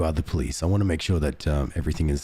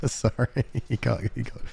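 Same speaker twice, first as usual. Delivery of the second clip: laughing; 7 semitones higher than usual. Real, not acted. Laughing fit after game joke.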